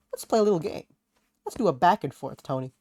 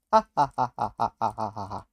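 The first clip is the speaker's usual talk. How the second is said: fake laugh; 5 semitones lower than usual. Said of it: "sorry for the fake laughs".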